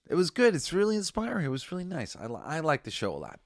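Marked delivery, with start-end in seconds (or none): 0.1-2.2 high, bright gush of praise. Real, not acted. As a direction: rave about it, voice lifted. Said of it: "gushing after pep talk".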